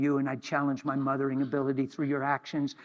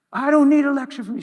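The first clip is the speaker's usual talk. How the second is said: high, loud defensive snap; touchy worker snapping, much louder and higher; angry snap; 12 semitones higher, 10 dB louder, faster than usual. Retold or imitated. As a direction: bite back at the preacher. Angry forklift driver snaps back.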